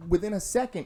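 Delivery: ordinary talk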